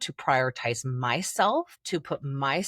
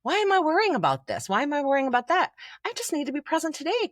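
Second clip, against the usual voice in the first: higher and quick, mock light-bulb moment; a touch of excited realisation; 9 semitones higher, faster than usual. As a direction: play the client suddenly getting it. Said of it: "and they're like".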